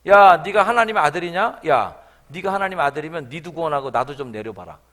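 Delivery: loud scoffing challenge; taunting jeer. Retold or imitated. Robber on the cross taunting Jesus, scoffing. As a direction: goad him, provoking. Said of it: "또 깐죽댑니다".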